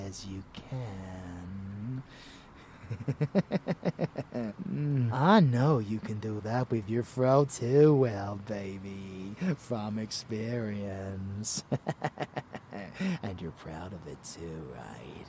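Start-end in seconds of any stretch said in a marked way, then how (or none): 2.7-4.6 staccato teasing chuckle. Acted. Teasing mentor amused by pupil. 11.7-13.2 short staccato chuckle; teasing chuckle. Acted. Teasing mentor amused by pupil.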